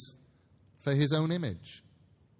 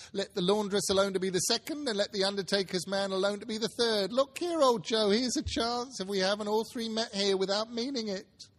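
put-on high old woman voice; 8 semitones higher than usual. Charwoman's excited speech.

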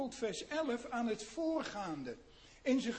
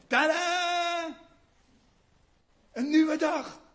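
joyful tada; 6 semitones higher, 9 dB louder, slower than usual. Acted. Joyful reveal of new morning.

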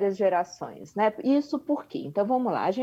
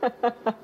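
light laugh; 3 semitones higher than usual. Real, not acted. Guest laughs at host's question.

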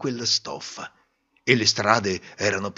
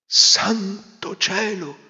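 high, slow astonished cry; startled father; 9 semitones higher, 4 dB louder, much slower than usual. Acted. Father hearing his daughter, astonished. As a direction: gasp it out. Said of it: "disse il padre".

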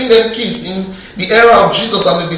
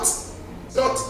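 whispered echo; 16 dB quieter than usual. Acted. Preacher whispering her point back to the church. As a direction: whisper "It's not" with no voice.

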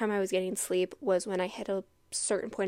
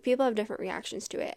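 ordinary talk, nothing marked

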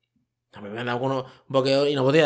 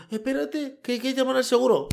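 much higher mock-fussy voice; mocking helpless homeowner; 10 semitones higher than usual. Mocking a helpless homeowner while the house floods, high and fussy. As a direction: whine it high like a useless know-it-all. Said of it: "Y tú".

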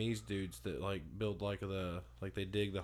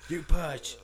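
loud high command to push; midwife shouting; 8 semitones higher, 10 dB louder than usual. Pretend. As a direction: bark it like a delivery nurse. Playing the midwife, coaching labor.

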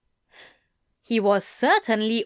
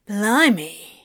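punchy, astonished outburst; amazed exclamation; 4 dB louder than usual. A narrator's reading. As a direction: blurt it out in amazement. Other demon amazed at the angel.

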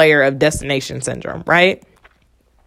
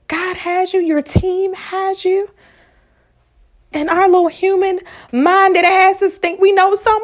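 normal talking voice

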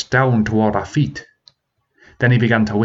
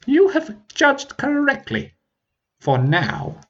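mocking dragon; slower than usual.

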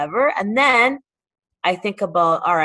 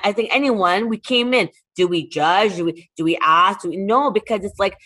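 ordinary talk, nothing marked

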